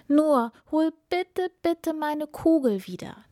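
high, imploring princess voice; pleading cry. A narrator's reading. Princess begging the frog for her ball, pleading cry. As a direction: beg for it, stretching the first words. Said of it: "rief die Prinzessin".

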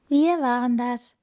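high, meek storybook voice; timid little voices. A narrator's reading. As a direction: confess as frightened little animals. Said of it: "flüsterten vier zittrige Stimmchen".

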